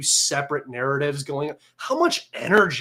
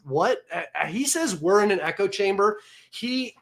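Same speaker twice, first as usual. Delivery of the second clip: ordinary delivery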